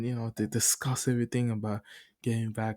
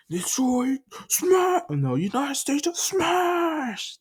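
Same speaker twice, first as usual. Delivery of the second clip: high, loud, slow battle cry; anime attack yell; 5 dB louder, slower than usual. Retold or imitated. All Might calls his final attack, loud and slow. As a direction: scream the finishing move.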